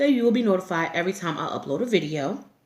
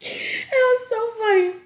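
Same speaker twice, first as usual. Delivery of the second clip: higher, slow, teary with laughter; laughing; slower than usual. Real, not acted. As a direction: say it while still cracking up. Still laughing about it.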